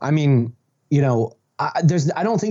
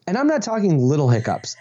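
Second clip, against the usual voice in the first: ordinary delivery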